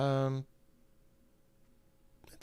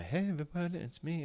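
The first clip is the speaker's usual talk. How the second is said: higher, bright greeting voice; chipper stream voice; 9 semitones higher than usual. Shown as a demonstration. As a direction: pop the pitch up for a cheery hello. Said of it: "I don't have my".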